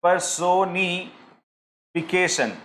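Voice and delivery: slow, syllable by syllable; slow demo. Shown as a demonstration. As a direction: spell it out slowly for the class. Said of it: "ये मैंने कहा".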